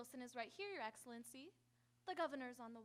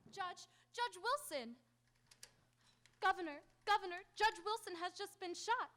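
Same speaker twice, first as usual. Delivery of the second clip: panicked alarm; 4 semitones higher, 5 dB louder than usual. Acted. Secretary discovers judge shot.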